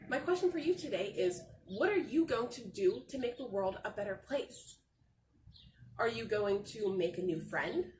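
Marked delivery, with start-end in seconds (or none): none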